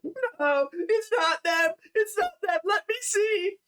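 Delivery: very high, wailing denial; crying wife. Acted. Wife refuses to believe her children died, high tearful wail. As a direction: play a distraught mother in tears. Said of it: "The wife is just crying".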